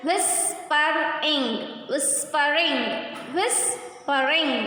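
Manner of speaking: "whispering" drawn out slowly, part by part; three-syllable demonstration. Shown as a demonstration. Teacher demonstrating three syllables, slow and segmented. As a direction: break "whispering" into whis, per, ing. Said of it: "third syllables".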